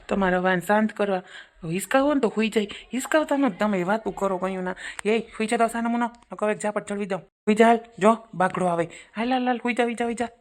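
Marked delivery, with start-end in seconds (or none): 5.0-10.3 scolding. Acted. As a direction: threaten the child into sleeping. Mother scolding child to sleep. (બાળકને ધમકાવતી માતા)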